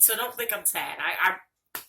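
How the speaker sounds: ordinary talk, nothing marked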